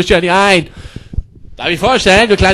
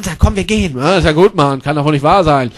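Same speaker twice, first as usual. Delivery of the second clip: usual speaking voice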